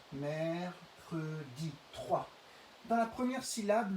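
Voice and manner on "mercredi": "Mercredi" very slowly, mer... cre... di; slow syllable-by-syllable "Mercredi"; counting syllables. Shown as a demonstration. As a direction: split "Mercredi" into three spaced syllables. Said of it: "combien de syllabes?".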